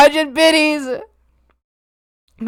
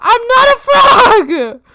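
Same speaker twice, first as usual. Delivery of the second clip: high, loud, drawn-out protest; indignant wail; 7 semitones higher, 8 dB louder, slower than usual. Acted. Streamer denies being a frog to chat, long indignant shout.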